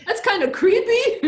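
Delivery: high laughing remark. Real, not acted. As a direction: laugh it out, amused. Laughs at creepy story detail.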